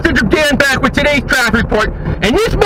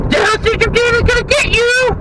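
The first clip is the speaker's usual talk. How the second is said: pitched way up, sing-song menace; mock threat; 11 semitones higher than usual. Dan taunts the driver who cut him off, high mock threat.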